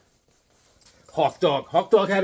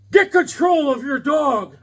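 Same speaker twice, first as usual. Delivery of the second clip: slow, high, loud imitation of outrage; mock angry yell; 9 semitones higher, 5 dB louder, slower than usual. Dog walker imitating old neighbor's complaint, angry mock yell. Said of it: "He's like".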